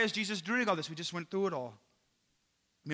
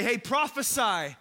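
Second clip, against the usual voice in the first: higher, slow mock command; retold taunt; 5 semitones higher, 5 dB louder, slower than usual. Soldiers to blindfolded captive, retold taunt. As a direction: taunt the captive with a drawn-out order.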